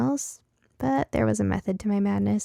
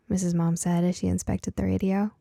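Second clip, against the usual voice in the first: plain reading voice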